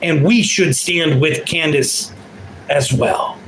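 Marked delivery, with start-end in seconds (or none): none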